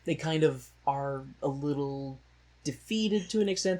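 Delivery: normal talking voice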